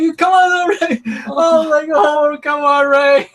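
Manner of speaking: high, loud pleading wail; mock crying plea. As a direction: plead desperately, acting out near-tears. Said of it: "I started him crying".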